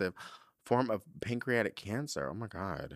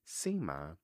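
ordinary delivery

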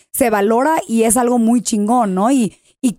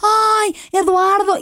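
much higher, mock swooning fan; 10 semitones higher, much slower than usual. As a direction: coo his name like a tipsy admirer. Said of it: "le dice".

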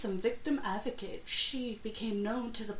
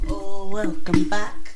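loud welcome; 14 dB louder, slower than usual. Real, not acted. Podcast host greeting loudly.

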